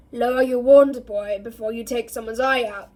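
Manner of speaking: low gruff growl, sharp start; growling Moody. Acted. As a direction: growl it like a gruff old auror. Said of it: "said a low, growling voice".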